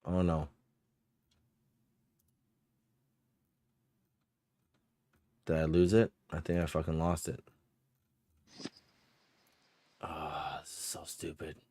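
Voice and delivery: muttering to himself, annoyed; frustrated muttering. Real, not acted. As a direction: grumble under your breath while searching. GM searching for lost item.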